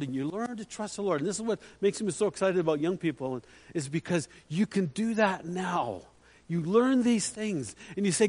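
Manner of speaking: usual speaking voice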